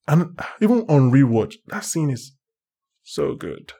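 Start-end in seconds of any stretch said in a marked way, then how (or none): 1.7-3.7 hushed awe. Real, not acted. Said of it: "emotional praise".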